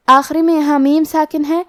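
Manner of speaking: ordinary talk, nothing marked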